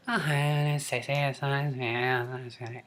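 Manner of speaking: tiny baby-voiced model, soft and breathy; put-on little-girl voice, weird and breathy; breathy baby-talk valley girl. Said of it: "utilize their voice in that way".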